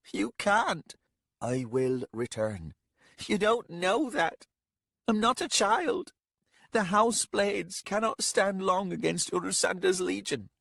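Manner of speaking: put-on a young lady refusing to be treated as a child; upset young lady. Young lady voiced by narrator.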